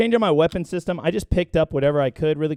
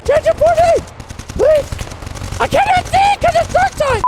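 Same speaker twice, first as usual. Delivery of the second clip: very high, loud panicked begging; terrified gibbering; 6 dB louder than usual. Real, not acted. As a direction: shriek it in total panic. Panicked plea in the dark.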